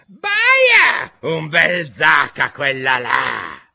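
high scream dropping into a slow yell; hammed-up queen's yell, falling in pitch; shrieking queen. A narrator's reading. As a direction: shriek the first word, then draw out the shouted order. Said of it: "e di strillare".

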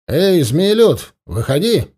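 stern uncle booming at the hidden shapeshifter; booming call. A narrator's reading. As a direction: call him out loud and slow. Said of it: "зычный голос Тугана".

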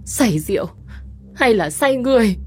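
high, cutting, falsely indignant; steely mock outrage. A narrator's reading. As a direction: needle him with fake righteousness. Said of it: "đanh thép đầy giả tạo". (giọng đanh thép đầy giả tạo)

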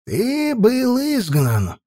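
slow, high, aged elder; old elder voice. Acted. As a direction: pronounce the judgement slowly like an old man. Old elder condemns Uchai.

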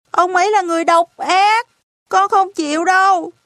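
put-on high, loud protesting child; loud high child's protest; child crying out. A narrator's reading. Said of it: "Arthur kêu lên".

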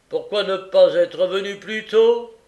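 loud, high, fast and curt; impatient magistrate. Acted. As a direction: hurry the witness along. Magistrate questions witness.

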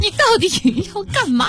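high, impatient boss snapping a question; exasperated boss. Pretend. Host as boss losing patience with employee, high exasperated. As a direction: demand it like a fed-up manager. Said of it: "你刚才学得挺像的".

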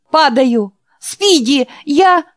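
high, loud panicked cry; shouting in fear. Acted. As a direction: yell out as you slip. Jack slipping, calls out falling.

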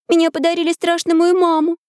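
put-on high, childlike girl's voice; little girl voice. A narrator's reading. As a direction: speak like an eager young girl.

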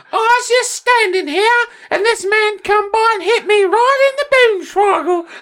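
very high falsetto British granny; hammed-up shrill old lady. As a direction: wail like a mugged English granny on the news.

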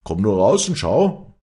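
calling out. Acted. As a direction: call him outside. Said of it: "rief Leander".

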